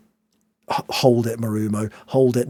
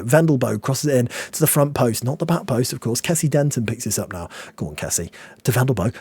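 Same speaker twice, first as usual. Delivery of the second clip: ordinary talk, nothing marked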